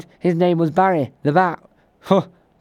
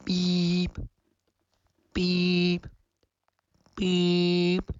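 soft, even "beep" pips; spoken beeps; 3 semitones higher, 5 dB quieter, much slower than usual. Pretend. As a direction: imitate radio time signal beeps. Mock radio time pips.

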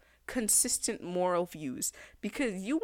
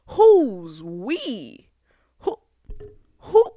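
loud outraged question; 12 dB louder, much slower than usual. Real, not acted. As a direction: belt it out, indignant. Ranting at Freud's theory.

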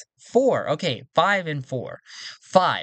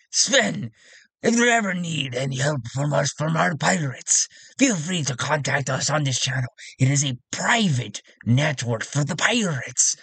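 pirate voice. Acted. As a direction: play a friendly pirate offering help. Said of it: "we'll do it in his pirate voice".

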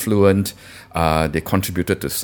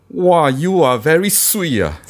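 put-on Singaporean accent; 8 semitones higher than usual. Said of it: "how would you say someone's good in, uh, in Singlish".

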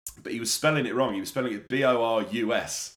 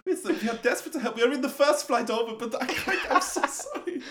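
giggling through a fake apology, pitched up; laughing mock apology; 10 semitones higher than usual. To the stranded fan, a laughing mock apology. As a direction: apologise while barely holding in laughter.

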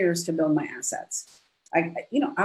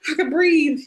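higher and louder, breathing free at last; "I can breathe" pitched up high and louder, falling on breathe; relieved outburst; 9 semitones higher, 5 dB louder than usual. Retold or imitated. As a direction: let the relief burst out. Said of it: "I was proud of myself going".

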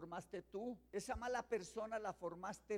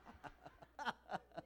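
quiet airy laughter; soft breathy laugh; 2 semitones lower, 8 dB quieter than usual. Real, not acted. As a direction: let out a hushed laugh. Joking about fake Rolex. (risa suave y aireada)

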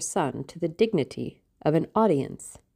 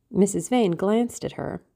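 plain reading voice

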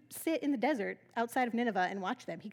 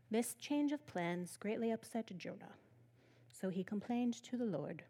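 flat bored reading; 4 semitones lower, 8 dB quieter than usual. Shown as a demonstration. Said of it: "No, you should be reading this with some attitude".

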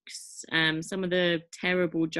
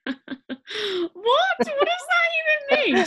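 high, squealing laugh through words; laughing disbelief; 6 dB louder, slower than usual. Real, not acted. Reacting to the silly joke.

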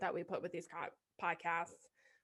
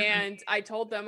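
ordinary talk, nothing marked

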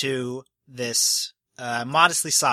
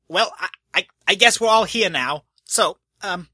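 stammering host; 5 semitones higher, 5 dB louder than usual. Acted. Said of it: "he says".

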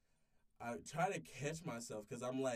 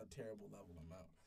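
usual speaking voice

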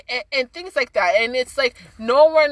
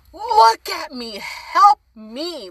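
high, drawn-out mock cry for help; retold yelling; 8 semitones higher, 4 dB louder, much slower than usual. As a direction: imitate a desperate yell, held back. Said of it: "I'm yelling, like".

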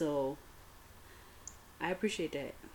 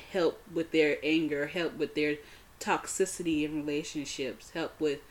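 normal talking voice

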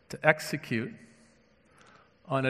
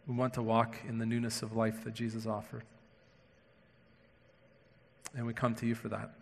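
hushed, low, unhurried prayer; low, reverent address to God; 4 semitones lower, 8 dB quieter than usual. Real, not acted. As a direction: pray softly with long pauses between phrases. Leads the congregation in prayer.

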